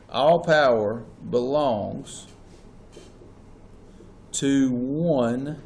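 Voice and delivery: slow dictation, "one" set apart and drawn out; stress on one. Shown as a demonstration. Teacher dictating notes to a class, stress on "one". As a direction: lean on "one" after a pause. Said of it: "emphasis on the word one".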